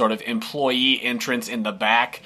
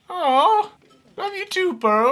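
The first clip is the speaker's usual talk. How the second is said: charmed cook voice; touched, high and tender. Acted. Franklin touched by kid.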